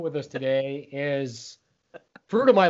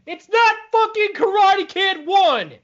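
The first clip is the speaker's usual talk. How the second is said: higher, louder, slow repeat; exasperated shout; 5 dB louder, slower than usual. Real, not acted. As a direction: yell the same correction.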